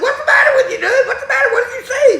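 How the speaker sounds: high, full-volume demand; alarmed shouted questions. Retold or imitated. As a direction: yell to find out what happened. Shouting questions at panicked friend.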